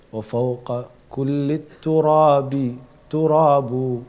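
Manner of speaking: quick, measured Arabic poetry recitation; recited Arabic verse. Acted. Preacher quoting a poet to the congregation, recited chant. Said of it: "One poet said so nicely".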